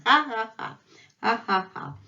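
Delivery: throaty Russian "ha" as a laugh; Messi. Acted. Teacher voices a footballer's laugh to show a letter, hard "ha". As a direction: say "Ha, ha, ha" with a hard, raspy h. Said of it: "That's what Messi is saying".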